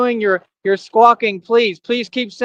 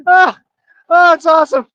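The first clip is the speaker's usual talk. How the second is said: normal talking voice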